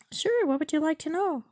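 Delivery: high-pitched bot voice; chatbot voice. Acted. Chatbot asks user what they would like to know, put-on high bot voice. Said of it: "let me try that, uh, voice thing".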